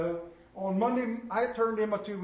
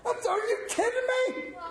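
exasperated disbelief. Real, not acted. Broken dryer turns on itself.

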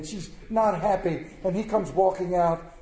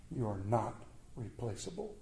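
soft tender reply; 6 semitones lower, 12 dB quieter than usual. Retold or imitated. Wife answers tenderly.

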